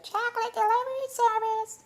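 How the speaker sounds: very high squeaky voice still held; lingering computer voice. Real, not acted. Streamer baffled by his own voice, still squeaking high. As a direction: keep the squeak while puzzling over it. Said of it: "I have no idea why I did that".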